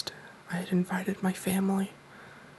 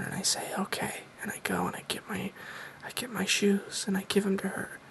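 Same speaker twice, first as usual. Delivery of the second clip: hushed whisper for a Q and A video; soft-spoken whisper. Real, not acted. Host whispers his Q and A to viewers, soft-spoken. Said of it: "I'm doing soft-spoken in this video".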